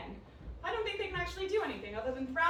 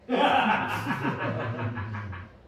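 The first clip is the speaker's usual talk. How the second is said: a low laugh at herself; breathy low laugh; 7 dB louder than usual. Acted. Laughs at her drugged state.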